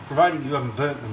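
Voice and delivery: ordinary delivery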